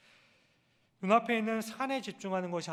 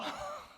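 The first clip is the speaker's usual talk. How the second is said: laugh; 3 dB quieter than usual. Real, not acted. Laugh after baby imitation.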